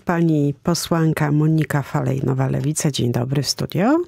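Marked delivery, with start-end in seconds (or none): none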